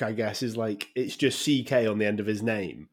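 ordinary talk, nothing marked

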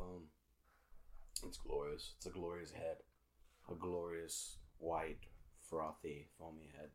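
soft, hushed seductive murmur; seductive whisper. Acted. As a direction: purr it low and close, barely audible. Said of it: "I'm being really seductive right now".

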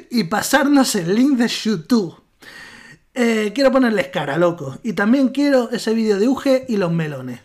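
put-on lively reading in an Argentine accent. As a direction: read it like a porteño. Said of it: "cuando no estoy leyendo, hablo normal".

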